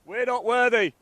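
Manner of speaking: loud, high, hammed-up praise; mock worship. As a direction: cry it out like bowing down. Mock bowing to the player.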